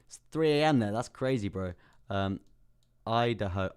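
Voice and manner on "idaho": Idaho, said as EYE-duh-hoe; careful first try at Idaho, EYE-duh-hoe; corrected pronunciation. Real, not acted. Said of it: "that's how you say it".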